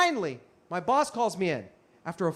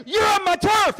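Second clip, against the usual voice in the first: high, loud furious challenge; imitating a raging street performer; enraged yell; 11 semitones higher, 7 dB louder than usual. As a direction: explode at someone in rage. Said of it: "all of the rage he had".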